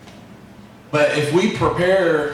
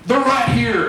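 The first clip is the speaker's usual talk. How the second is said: higher, mock exasperated dad; annoyed dad; 8 semitones higher than usual. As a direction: toss it off like an irritated father. Dad finds tool, scolding son.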